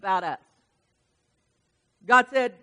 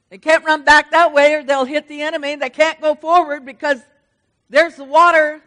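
full-force preaching; 4 semitones higher, 14 dB louder than usual. Acted. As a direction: drive the crowd with rising fervor.

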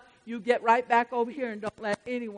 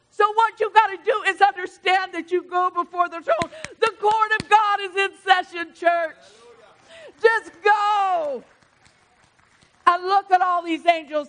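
loud, high, fervent pulpit preaching; preaching at full force; 8 semitones higher, 10 dB louder than usual. Acted. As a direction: rouse the church from the pulpit.

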